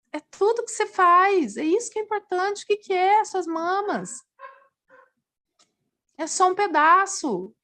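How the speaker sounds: emphatic pep talk. Retold or imitated. Nurse friend urging her to accept surgery, high insistent plea. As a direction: press the point hard, high and pleading.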